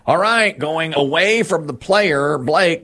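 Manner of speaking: slow, high-pitched announcer build-up; climbing, drawn-out host call; game-show suspense build. Real, not acted. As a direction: stretch it out toward the big reveal. Host builds up steal attempt.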